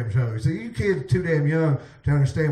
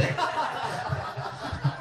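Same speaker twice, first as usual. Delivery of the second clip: wheezy breathless laughter; breathy laugh; 5 semitones higher, 9 dB quieter than usual. Real, not acted. Laughs at own slip.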